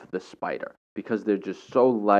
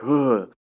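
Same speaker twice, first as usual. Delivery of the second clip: disgusted groan; 5 semitones higher, 6 dB louder than usual. Retold or imitated. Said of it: "they were like".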